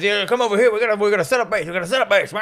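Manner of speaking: high-pitched mock army orders; army man. Army man setting up base, high urgent mock orders. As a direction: hurry out orders like a busy soldier.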